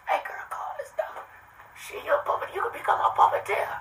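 put-on high, soft, airy pitch to a passing girl; mock sweet-talk. Sweet-talking a passing girl to call the number, high and soft. Voicing his pitch to women.

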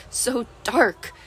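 ordinary delivery, nothing marked

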